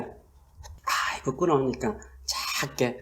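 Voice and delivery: very soft, half-whispered bashful murmur; hushed mock embarrassment; mock timid hush. Shown as a demonstration. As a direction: shrink the voice as if too shy to be heard. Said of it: "작게 한 게 아니라".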